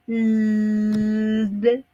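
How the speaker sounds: a long sustained "l"; held l sound. Shown as a demonstration. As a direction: hold the "l" like a hum. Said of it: "Por ejemplo".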